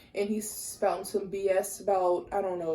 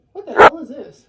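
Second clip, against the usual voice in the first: loud, exasperated cry at her makeup; irritated outburst; 6 semitones higher, 16 dB louder than usual. Real, not acted. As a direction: snap at the mirror in frustration. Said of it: "this is how irritated it makes me".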